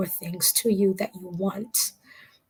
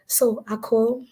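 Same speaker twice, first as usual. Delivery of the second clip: normal talking voice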